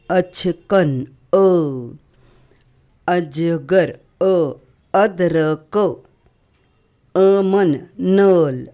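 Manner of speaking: अचकन and अजगर drawn out very slowly; slow demo words. Shown as a demonstration. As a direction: stretch each word so kids hear the अ. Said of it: "आपका pronunciation अ".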